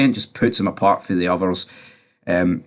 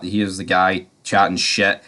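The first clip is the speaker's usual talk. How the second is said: ordinary talk, nothing marked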